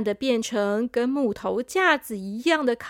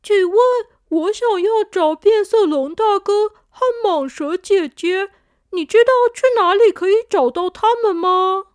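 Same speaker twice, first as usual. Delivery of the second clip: a high, eager little piglet asking politely; 6 semitones higher, 7 dB louder than usual. Acted. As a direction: ask like a small curious piglet. Little pig asks for friends.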